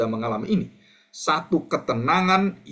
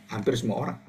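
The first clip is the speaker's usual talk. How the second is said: usual speaking voice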